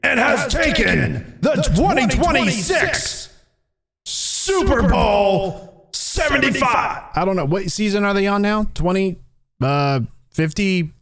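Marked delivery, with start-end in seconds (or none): 0.0-7.0 high, slow shouted game call; sports announcer. Acted. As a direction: boom out the historic win. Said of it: "It's like Dallas just won the Super Bowl".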